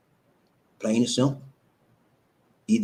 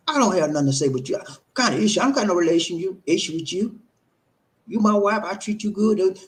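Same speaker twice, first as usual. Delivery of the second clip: hammed-up defensive husband, high and quick; dismissive husband; 8 semitones higher than usual. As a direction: rattle off his denial like a defensive husband. Role-play of an angry husband.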